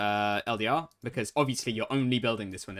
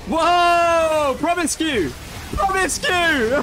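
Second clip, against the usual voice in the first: excited shout; 10 dB louder, much slower than usual. Real, not acted. Excited yelling at teammate.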